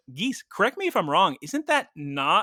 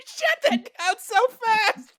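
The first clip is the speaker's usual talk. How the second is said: laughing disbelief; 3 dB louder, slower than usual. Real, not acted.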